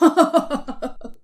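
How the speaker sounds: jeering burst of laughter; mocking laugh. Classmate laughs after mocking Qamar's name, jeering. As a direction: laugh at your own cruel joke. Boy laughs at his own taunt.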